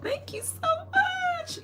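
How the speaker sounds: very high, stretched-out squeal of gratitude; squeaky drawn-out thanks. Real, not acted. Thanking someone for a compliment, squeaky and drawn out. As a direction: squeak it out slowly, sky-high.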